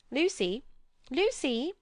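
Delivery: family calling Lucy from outside. A narrator's reading. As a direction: call her name from the carriage. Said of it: "called voices from the carriage".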